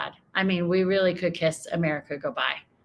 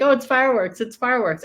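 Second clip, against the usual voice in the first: mocking gang quote; 5 semitones higher, 4 dB louder, faster than usual. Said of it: "They're like".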